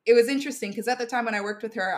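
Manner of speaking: ordinary delivery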